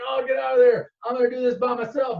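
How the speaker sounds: urgent order. Retold or imitated. Luke ordering his buddy away, urgent.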